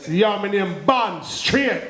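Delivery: slow, laid-back Jamaican yardie drawl; yardie Bond. Retold or imitated. Comic's yardie Bond strolling into the casino, slow Jamaican drawl. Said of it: "Yardie James Bond".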